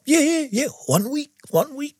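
high-pitched, hasty mock promise; eager promising. Retells own hurried promise.

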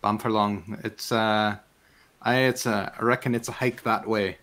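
hesitant Marish fisherman; Marish accent. Acted. Fisherman giving travellers directions, Marish accent. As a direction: reckon it out in a rural Marish accent. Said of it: "talking in this accent because it's amazing".

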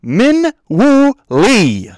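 high, loud, drawn-out name reveal; big ringing proclamation, stretched out; dramatic announcement. Real, not acted. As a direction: announce each word slowly like a grand introduction. Dramatic reveal of his pick.

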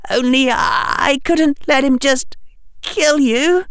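put-on high, stammering scared boy; a crying child struggling to start; wavering boy's voice. A narrator's reading. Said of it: "the boy's wavering voice said".

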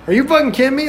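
high-pitched, indignant retold outburst; acted-out exasperation, pitched well up; incredulous anger. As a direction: confront him in disbelief. Retold confrontation with taxidermist.

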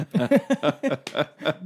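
a bright burst of self-mocking laughter; high, amused chuckle. Real, not acted. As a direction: laugh at how obvious it was. Epiphany, laughing at himself.

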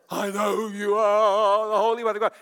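loud, stretched-out wail of dread; terrified demon. Acted. Demon in the man recognizes Jesus, loud terrified wail. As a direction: wail it loudly, drawing out the words. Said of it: "the demon's terrified of Jesus".

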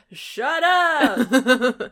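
loud high yell; shout. Retold or imitated. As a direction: yell it at them. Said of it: "They're like".

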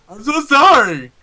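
loud, high, drawn-out sorry; mock apology. Joking about the co-host being scolded, loud mock apology.

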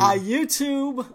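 YouTube said slow and high; emphatic correction. Shown as a demonstration. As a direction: stress YouTube, drawn out. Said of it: "Gary's on red tube".

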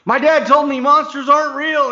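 put-on high, loud little boy voice; child insisting monsters aren't real; kid's retort. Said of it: "And he goes".

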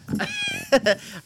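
high laugh. Real, not acted. Laughs at friend.

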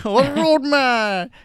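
loud, high, slow Jamaican roadman drawl on "roadman"; drawn-out Jamaican roadman. Acted. Host hamming up his Jamaican roadman impression, slow and loud. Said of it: "Jamaican roadman".

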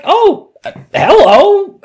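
high, loud startled greeting; surprised hello. Retold or imitated. Caught by a stranger's greeting, startled high hello. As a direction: caught off guard, chirp it back. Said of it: "And I was like".

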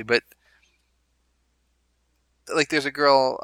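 usual speaking voice

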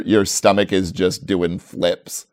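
ordinary delivery

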